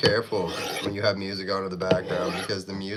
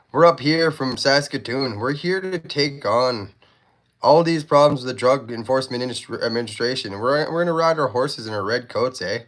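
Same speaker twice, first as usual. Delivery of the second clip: loud, higher-pitched Canadian caricature; 4 semitones higher, 5 dB louder than usual. Acted. Mocking how people picture Canadians, hammed-up Canadian. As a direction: put on a hearty Mountie's Canadian accent. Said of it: "when people picture Canadians".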